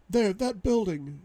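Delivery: high-pitched woman's voice. Acted. Captive woman on the ground, high urgent reply.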